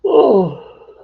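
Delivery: big high yawn; loud yawn. Real, not acted. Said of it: "Bill makes me yawn".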